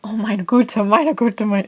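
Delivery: mildly acted freak-out, repeating in alarm; retold boyfriend's alarm. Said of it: "he was just freaking out".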